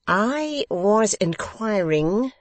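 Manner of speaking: resume the point grandly; pompous host resuming his question. A narrator's reading.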